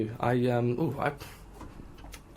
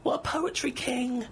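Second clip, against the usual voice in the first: mock fan cheer; slower than usual. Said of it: "They'll be saying".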